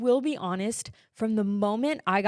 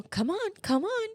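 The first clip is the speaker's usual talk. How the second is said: retold nurses urging; 10 semitones higher than usual. Nurses working on a limp newborn, imitated high urging. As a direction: urge in a much higher pitch, lifting at the end.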